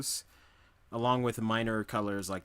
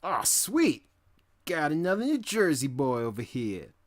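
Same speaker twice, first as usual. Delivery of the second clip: loud, high, hammed-up New Jersey accent; mock Jersey accent; 5 semitones higher, 7 dB louder than usual. Streamer greeting a fellow Jersey viewer, mock Jersey accent. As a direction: crow it in a caricature Jersey accent. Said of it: "talk like that".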